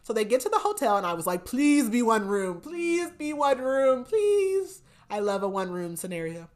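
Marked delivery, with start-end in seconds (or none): 1.5-4.8 slow, drawn-out plea climbing higher; excited mock begging. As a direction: beg with stretched words, rising each time. Reader begging for one-room plot.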